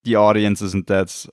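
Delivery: ordinary talk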